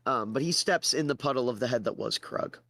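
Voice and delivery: ordinary talk, nothing marked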